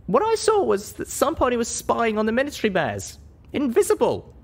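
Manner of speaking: put-on a high young pony heroine choosing her words; heroine's voice. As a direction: speak up as the small, earnest heroine. Heroine reveals a discovery.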